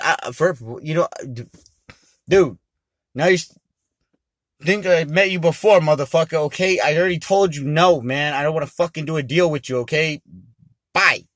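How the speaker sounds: loud and high, angrily dismissive; angry refusal. Retold or imitated. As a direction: shut him down loudly and storm off. Refusing the deal.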